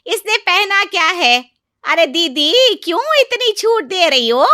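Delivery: very high, loud outrage; angry yelling. Acted. Aunt-in-law blames her sister-in-law for giving freedom, angry. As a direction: shout your disapproval at her. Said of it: "चाची के गुस्से को देखते ही".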